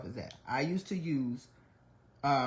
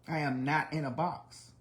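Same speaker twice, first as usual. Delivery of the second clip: usual speaking voice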